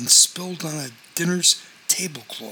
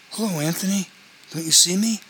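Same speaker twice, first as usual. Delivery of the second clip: put-on higher-pitched questioning greeting from a kid; lightly voiced kid; 5 semitones higher, faster than usual. A narrator's reading. Ignored kid calls hello to Anthony, higher and questioning. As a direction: pitch up a little, as a kid seeking notice.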